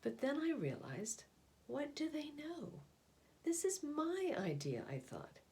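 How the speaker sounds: put-on gentle storybook narration for children; picture book reading. A narrator's reading. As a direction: read it like a bedtime picture book. Reads picture book aloud.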